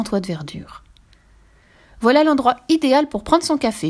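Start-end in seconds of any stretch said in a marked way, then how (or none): 2.0-3.9 higher, louder, delighted exclamation; exclaiming. A narrator's reading. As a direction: announce it with bright eagerness. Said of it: "s'exclama Fifi". (exclamation enthousiaste)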